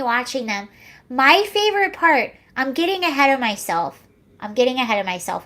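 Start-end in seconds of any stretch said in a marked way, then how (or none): 4.4-5.4 quick self-correcting aside, lower; fast muttered repeat. Real, not acted. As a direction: rattle it off fast and lower, under the breath. Repeats phrase, second time rushed.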